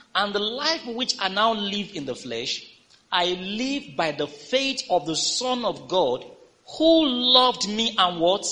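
slow, high, loud preached reading; lifted scripture proclamation. Acted. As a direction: proclaim each phrase from the pulpit. Reads scripture aloud.

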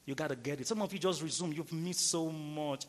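usual speaking voice

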